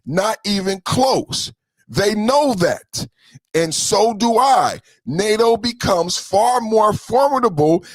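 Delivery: slow, weighty reading of a political post; deliberate proclamation read; emphatic statement read. Acted. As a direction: read it out slowly, leaning on each phrase. Reads Trump's post aloud.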